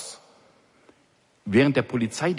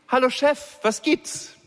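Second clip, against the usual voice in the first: bright, much higher, breezy greeting; carefree friendly call; 13 semitones higher, slower than usual. A narrator's reading. Officer greeting her fuming boss all innocent and friendly. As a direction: sing out the hello innocently. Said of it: "rief so unbefangen und freundlich wie nur möglich".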